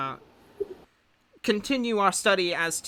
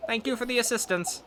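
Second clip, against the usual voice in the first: lightly voiced higher friendly scholar; a hint of the cheerful scholar; Zebek's voice; 8 semitones higher, 3 dB louder than usual. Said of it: "Zebek kind of says".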